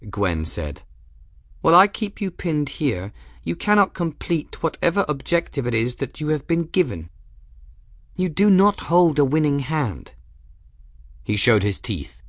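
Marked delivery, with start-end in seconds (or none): 1.6-10.1 furious commanding lady's voice; grand hollywood dame. Acted. Gwen's imperious voice.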